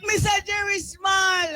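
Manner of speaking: calling out. Real, not acted. Calling out to someone across room.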